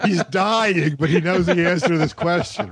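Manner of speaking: high-pitched words through laughter; giggling while teasing a friend; laughing. Real, not acted.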